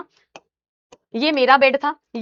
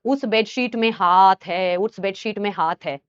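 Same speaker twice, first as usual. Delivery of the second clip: put-on possessed girl; 7 semitones lower, slower than usual. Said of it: "ऐसे करते करते बोलती है".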